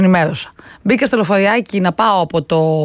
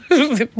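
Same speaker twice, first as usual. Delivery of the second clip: short laugh; 4 semitones higher than usual. Real, not acted. Amused at being recorded.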